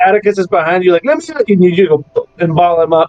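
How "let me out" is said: high, urgent creature plea. Acted. Game master voices the bird behind its trainer, high urgent plea. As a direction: pipe up insistently in a high voice. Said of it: "So as Atticus is be".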